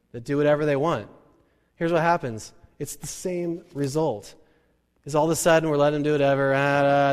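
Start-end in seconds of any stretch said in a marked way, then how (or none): none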